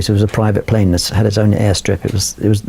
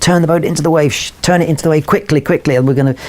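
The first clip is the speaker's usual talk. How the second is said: higher, urgent yelled order; urgent shout; 7 semitones higher than usual. Retold or imitated. Retelling a shouted order to the boat's helmsman, urgent yell. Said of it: "I just screamed to the guy".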